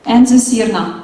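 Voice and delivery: usual speaking voice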